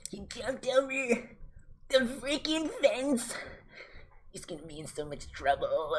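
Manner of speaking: tattle in disbelief; teacher on phone telling on the kid, scandalised; shocked teacher. Retold or imitated.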